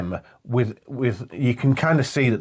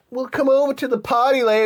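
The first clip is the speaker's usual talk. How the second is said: put-on girls' voice; 12 semitones higher than usual. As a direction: sing it up high like the girls. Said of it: "them girls are like".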